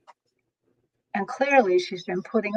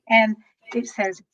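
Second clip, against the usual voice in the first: normal talking voice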